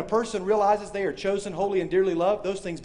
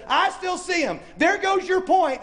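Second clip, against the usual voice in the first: smug heckler; 10 semitones higher than usual. Retold or imitated. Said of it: "Somebody up close always says".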